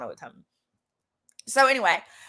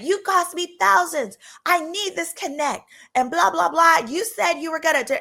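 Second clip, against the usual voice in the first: mock angry rant; 7 semitones higher, 3 dB louder than usual. Date cussing a business partner out, mock fury. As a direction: bite off each accusation like he's chewing someone out. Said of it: "He's cussing and fussing this person out".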